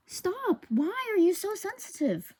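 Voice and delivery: high-pitched frustrated plea; very high, fed-up outburst; exasperated scolding. Real, not acted. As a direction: whine at the mic in exasperation. Scolding the too-sensitive microphone.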